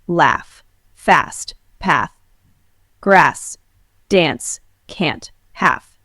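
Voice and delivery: American accent, flat front A in "laugh, fast, path"; American accent: keep "grass, dance, can't" flat like "cat"; American accent A demo. Shown as a demonstration. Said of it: "In American English, we say".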